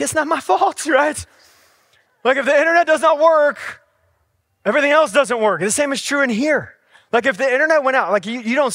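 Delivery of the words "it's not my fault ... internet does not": defensive protest. Retold or imitated. Dad defends himself to daughter.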